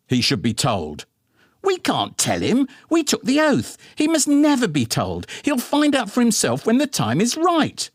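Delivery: comic bickering couple; heated parental quarrel. A narrator's reading. Parents' heated quarrel, high voices.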